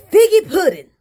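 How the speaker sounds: loud, high cry of frustration; frustrated yell. Acted. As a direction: yell it out to the heavens in exasperation. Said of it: "yelled out in frustration".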